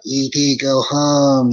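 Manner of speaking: slow, drawn-out alien croak. Retold or imitated. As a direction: croak it out slowly like ET. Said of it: "the alien goes".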